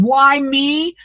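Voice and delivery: anguished cry to God. Retold or imitated. Crafter tells viewers they can ask God why me. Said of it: "you can ask him".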